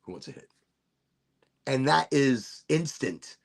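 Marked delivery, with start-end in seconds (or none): 0.0-0.5 hushed offer. Pretend. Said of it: "I'm like".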